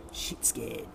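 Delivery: low hushed repeat; leaning in to whisper. Real, not acted. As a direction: drop the voice right down. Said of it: "I'll come in close".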